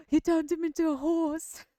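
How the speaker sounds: high, loud shocked gasp; gasping surprise. Retold or imitated. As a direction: gasp in amazed disbelief. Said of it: "gasped and said".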